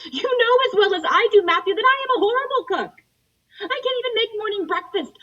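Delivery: high and loud, worked up; exasperated. Acted. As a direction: pour it out, fully worked up. Exasperated confession to brother.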